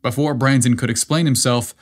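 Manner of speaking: ordinary delivery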